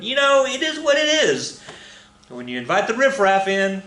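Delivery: high, sing-song sarcastic aside; mock snooty. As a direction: sniff at the riffraff with lofty disdain. Snide joke about the riffraff.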